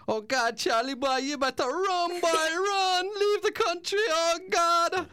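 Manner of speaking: very high, loud, slow panic; panicking neighbour. Retold or imitated. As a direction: shriek it like a terrified neighbour. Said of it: "my neighbour went".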